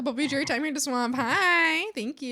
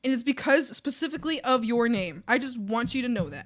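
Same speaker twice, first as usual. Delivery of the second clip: ordinary talk, nothing marked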